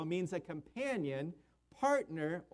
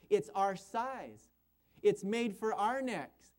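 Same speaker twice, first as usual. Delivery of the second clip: ordinary delivery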